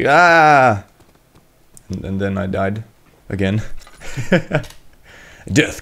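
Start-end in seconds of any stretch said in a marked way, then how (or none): 0.1-0.8 loud, drawn-out mock scream; retold scream. Gamer retelling a closet scare, imitated scream. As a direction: act out the fright with a yell. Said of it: "And I was like".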